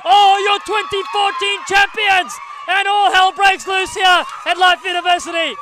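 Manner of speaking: high, loud, roaring title call; ecstatic commentary. Real, not acted. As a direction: scream the championship home. Final whistle, title won.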